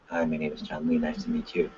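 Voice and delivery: a shy, beaten-down introduction; timid greeting. Shown as a demonstration. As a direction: mumble it like you'd rather disappear. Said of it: "who just won?".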